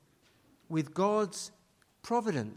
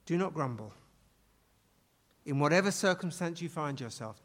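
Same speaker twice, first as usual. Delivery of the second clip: ordinary talk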